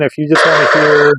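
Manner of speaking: normal talking voice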